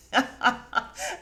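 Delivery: loud laugh, breaking up. Real, not acted. Host laughing at the hairdresser dig, bursting out laughing.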